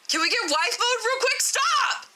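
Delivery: very high-pitched pleading squeal; playful high outburst; mock flustered squeal. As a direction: squeal it way up high, ending on a yelp.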